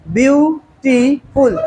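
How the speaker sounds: ब्यूटीफुल slowly split, byu... tee... ful; slow three-beat breakdown of ब्यूटीफुल; syllable by syllable. Shown as a demonstration. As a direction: stretch ब्यूटीफुल with gaps between three syllables. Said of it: "three syllable".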